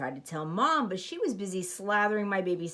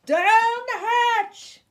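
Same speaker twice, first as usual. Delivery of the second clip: much higher, louder, slow crew roar; crew shout; 10 dB louder, much slower than usual. Acted. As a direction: bellow it together. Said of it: "we all shouted".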